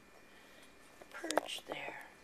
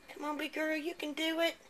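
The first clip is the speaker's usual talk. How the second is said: talking to a pet; 6 semitones higher, 4 dB quieter than usual. Real, not acted. Encouraging cat.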